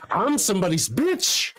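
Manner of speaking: high, slow hammy line; hammed-up quote. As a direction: drag it out comically. Quoting Half Baked.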